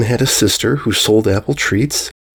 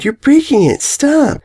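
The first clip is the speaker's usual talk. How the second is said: high, alarmed cry to stop. Acted. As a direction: yell in panic as it wobbles. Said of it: "The unicorn filly cried".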